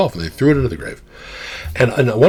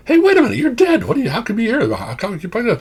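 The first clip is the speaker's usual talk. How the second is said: much higher, loud, startled "wait a minute"; startled disbelief; 14 semitones higher, 4 dB louder than usual. Retold or imitated. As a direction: suddenly realize you're talking to a dead friend. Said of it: "I'm going".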